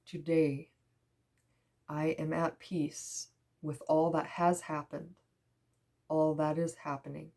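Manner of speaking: hushed, meditative reread with long pauses; softly spaced phrases, sinking into peace; soft affirmation reading. Real, not acted. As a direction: let each phrase settle, fading to near whisper.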